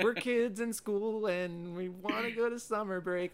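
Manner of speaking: high, slow sing-song mockery; hammed-up generic musical line; mocking sing-song.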